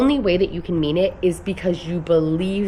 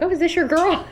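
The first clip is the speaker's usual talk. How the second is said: higher, playful teasing imitation of Tyler; teasing husband; 11 semitones higher than usual. Husband teasing her about her online girl crush, playful mock voice. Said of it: "even Tyler was like".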